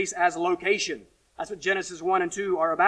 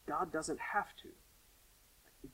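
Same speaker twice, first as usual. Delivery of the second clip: very slow, whispered reveal; slow breathless whisper of the hard part; hushed whisper; 10 dB quieter, much slower than usual. Acted. Hushed key point.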